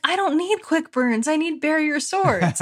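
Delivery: high, exasperated quote of oneself; mock protest. Host turning down the friend's link, mock protest. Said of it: "And I was like".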